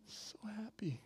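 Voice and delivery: hushed, airless delight; overjoyed whisper. Retold or imitated. Father seeing his daughter, happy whisper. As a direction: whisper it, overcome with happiness.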